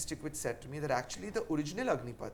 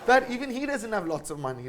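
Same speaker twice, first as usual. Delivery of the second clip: laughing; 8 semitones higher, 6 dB louder than usual. Real, not acted. Laughing admission about Karan Johar.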